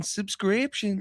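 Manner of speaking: high, soft, drawn-out Southern belle; imitated Southern damsel in distress. Editor imitating the friend's fake accent, high Southern damsel. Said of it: "sound like an, like a old Southern damsel in distress".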